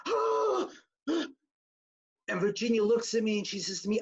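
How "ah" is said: sudden high-pitched gasp of fright; a short shocked cry; startled scream. Retold or imitated. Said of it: "she went like".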